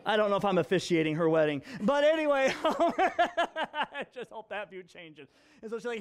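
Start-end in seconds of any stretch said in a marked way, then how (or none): 1.8-5.3 real laughter while talking, dragged out slowly; laughing. Real, not acted. Father jokes about officiating, laughing through the words.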